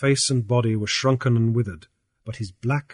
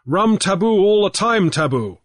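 raised, lively pidgin English; Grief's pidgin voice; 6 semitones higher, 4 dB louder than usual. A narrator's reading. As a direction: talk big to the old chief in pidgin. Grief answers Coho in pidgin.

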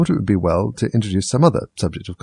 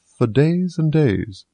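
drawn out; 6 semitones higher, much slower than usual. A narrator's reading. Footman drawling.

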